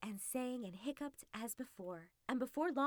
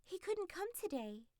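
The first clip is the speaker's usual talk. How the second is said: high, polite fox voice; the fox bowing and apologizing for her master; pitched-up courtly excuse; fox making excuses; 8 semitones higher than usual. Acted. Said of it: "said to the king".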